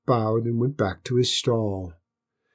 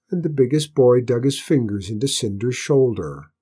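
usual speaking voice

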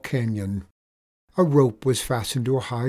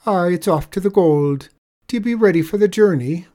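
higher, louder, quicker brisk Irish voice; cheerful leprechaun; 6 semitones higher, 7 dB louder than usual. Acted. Leprechaun leading the tradesman to the gold, brisk higher voice. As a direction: hurry him along brightly.

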